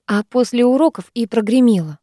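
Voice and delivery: ordinary delivery, nothing marked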